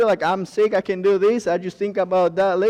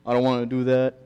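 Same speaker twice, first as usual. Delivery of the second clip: whiny excuses; 5 semitones lower than usual. Retold or imitated. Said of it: "what I say sometimes".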